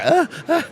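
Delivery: high, gasping mock sobs; upset four-year-old whimper. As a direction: imitate a small child too upset to talk. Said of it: "when your four-year-old's so upset".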